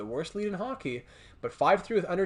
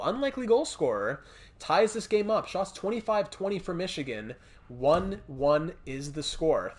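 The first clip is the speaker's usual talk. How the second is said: normal talking voice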